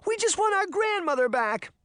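very high-pitched desperate plea; thin high begging. A narrator's reading. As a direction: plead like a distraught child. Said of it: "Sabrina begged".

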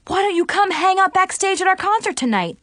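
high, eager invitation; excited. A narrator's reading. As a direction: ask it with bright excitement. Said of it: "they said excitedly".

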